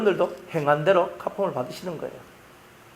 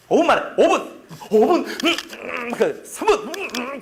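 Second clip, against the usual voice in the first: high, hurried mock panic with a laugh; frantic rushing recruit; 6 semitones higher, slower than usual. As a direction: play the recruit scrambling against the clock. Frantic soldier racing clock retold.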